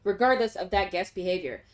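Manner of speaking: low, even formal reading; formal letter read. Acted. Player reading the hotel manager's letter, low formal read. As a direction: read it like a polite hotel notice.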